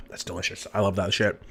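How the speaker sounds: breathy whisper after a sigh. Real, not acted. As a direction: whisper it under your breath. Savoring a bite, whispering.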